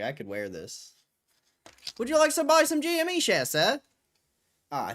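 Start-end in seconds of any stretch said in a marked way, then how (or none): 2.0-3.8 high, loud newsboy holler. Pretend. Newsboy greeting a customer to sell shares, high hawking shout. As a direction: hawk it like an old-time newsboy. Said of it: "Just so I can talk in that voice".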